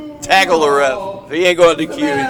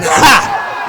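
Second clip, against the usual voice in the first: gleeful laugh; 6 semitones higher than usual. Real, not acted. Cheering a low blow.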